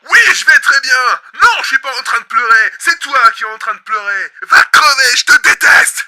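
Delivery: high, very loud yelling; furious denial. Acted. Host yelling at himself that he is not crying. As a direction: yell through hurt.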